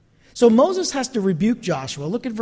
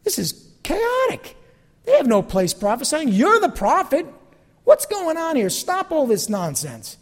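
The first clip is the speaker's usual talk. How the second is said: indignant Joshua; 8 semitones higher than usual. Retold or imitated. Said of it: "confident enough to say".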